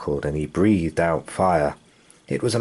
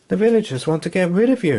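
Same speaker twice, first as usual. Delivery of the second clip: put-on high, fast breathless boy; rushed frightened warning; gasping; 11 semitones higher, 3 dB louder, faster than usual. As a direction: blurt it out in panic. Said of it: "he gasped".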